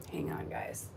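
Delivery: whispered aside. Real, not acted. Stepping away from mic.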